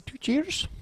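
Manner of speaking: very high, soft squeak; mock toast. Comic offering a high squeaky toast to the crowd.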